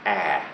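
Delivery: "ah" as the short open a of US cat; vowel sound demo. Shown as a demonstration. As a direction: model the isolated "ah" vowel of cat. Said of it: "that crazy thing is".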